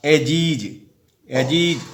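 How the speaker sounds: एजीज ending in a clear ज sound; final s as j. Shown as a demonstration. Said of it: "तो जौ होगा".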